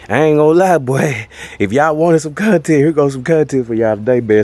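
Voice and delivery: panting between words; breathless, still catching air; out of breath. Real, not acted. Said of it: "man, I'm out of breath".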